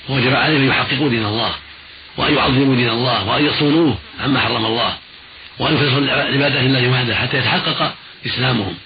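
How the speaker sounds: ordinary talk, nothing marked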